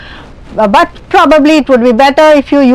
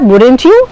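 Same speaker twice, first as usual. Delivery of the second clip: YOU swept up at the end; rising tag ending; 3 dB louder than usual. Shown as a demonstration. Teacher isolating the tag ending, rising on YOU. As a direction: lift the pitch clearly on YOU. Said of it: "that ending of the tag question".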